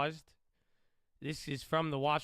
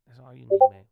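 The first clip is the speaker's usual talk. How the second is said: loud emphatic repeat; emphatic shout; 19 dB louder, faster than usual. Real, not acted. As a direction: punch it out loudly.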